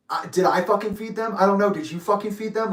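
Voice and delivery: retold in-your-face challenge, high-pitched; confrontational snapping. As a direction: square up and challenge, retold heatedly. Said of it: "he gets up in my face".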